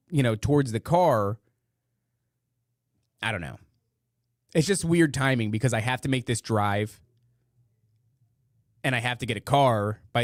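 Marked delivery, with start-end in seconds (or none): none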